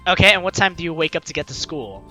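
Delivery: normal talking voice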